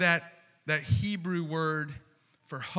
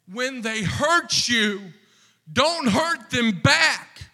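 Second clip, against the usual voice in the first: rising plea; 7 semitones higher, 6 dB louder, slower than usual. Shown as a demonstration. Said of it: "repeated phrase, second louder".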